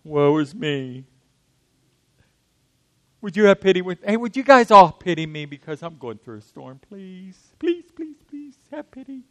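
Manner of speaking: hammed-up self-pity, pleading please, please; self-pitying whine. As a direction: beg for pity, wallowing in it. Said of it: "we can nurse our storm".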